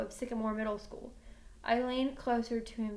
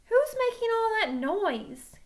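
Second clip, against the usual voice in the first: annoyed; 10 semitones higher, 6 dB louder than usual. Acted. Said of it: "I asked in annoyance".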